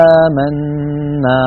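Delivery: آمنا with a light alif after the hamza. Shown as a demonstration. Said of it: "الهمزة هنا خرجت مرققة". (ألف مرققة)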